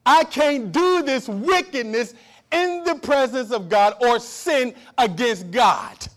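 loud, slow preaching cry; preaching at full force. Acted. Preacher thundering at the congregation, slow and loud. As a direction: drive it home from the pulpit.

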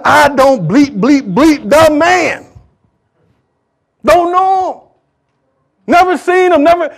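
high, loud, drawn-out protest; strong denial. Acted. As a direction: act out Peter cursing and denying. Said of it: "he denied the Lord so strong".

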